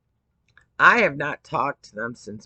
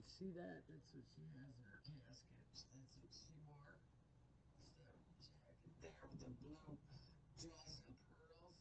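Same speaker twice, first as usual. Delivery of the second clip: breathless whisper, much softer; hushed whisper; 37 dB quieter than usual. Real, not acted. Mother showing her daughter's burial dress, real hushed whisper. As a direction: whisper it as if beside the casket.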